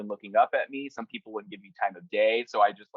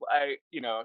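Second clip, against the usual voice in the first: ordinary talk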